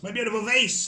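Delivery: high, loud anguished yell; yelling in pain. Acted. Cyprianus hit in the face, yelling in high pain. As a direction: wail over a ruined face. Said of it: "he yells".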